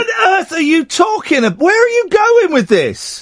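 what on earth, shouted up high; incredulous. Real, not acted. Incredulous outburst.

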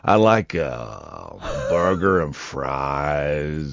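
hammed-up Texas cowboy drawl; Texan cowboy. Host pretends to be a Texan to his co-host, low slow Texan drawl. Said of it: "Texas, they talk more like that".

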